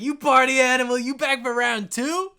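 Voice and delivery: loud, high party holler, stretched out at the end; rowdy dwarf. Acted. Dwarf slaps Wilhelm on the back, rowdy cheer. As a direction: egg on a drinking buddy boisterously.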